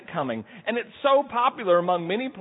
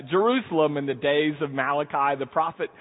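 normal talking voice

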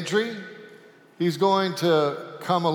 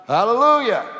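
loud high joyful shout; shouted Hallelujah; 9 semitones higher, 10 dB louder than usual. A narrator's reading. Preacher's command word for the donkey, shouted loud and high. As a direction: belt it out like a revival cry.

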